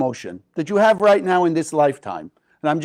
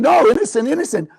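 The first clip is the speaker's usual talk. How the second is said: higher, louder desperate protest; pleading innocence; 8 semitones higher, 4 dB louder than usual. Acted. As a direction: cry out innocence under the blows. Beaten man protesting innocence.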